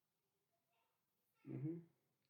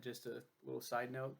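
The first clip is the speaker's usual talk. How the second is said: ordinary talk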